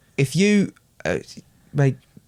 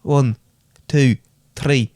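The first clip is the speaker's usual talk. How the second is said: louder Irish count; Irish accent; 3 dB louder, much slower than usual. Shown as a demonstration. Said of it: "count to three in Irish".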